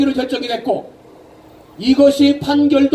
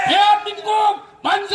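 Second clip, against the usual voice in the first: shrill echoing propaganda broadcast; 6 semitones higher than usual. Real, not acted. Leading cheers.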